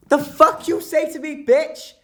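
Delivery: very high, very loud scream; furious shriek. Retold or imitated. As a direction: scream it in her face. Street row, yelling back.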